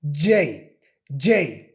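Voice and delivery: J said with the teeth closed tight. Shown as a demonstration. Teacher showing a closed-teeth J. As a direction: clench the teeth on J. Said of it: "pronounce the J sound closing my teeth".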